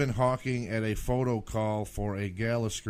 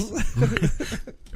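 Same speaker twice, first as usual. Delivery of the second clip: laughing; 9 semitones higher than usual. Real, not acted. Laughing at the clip.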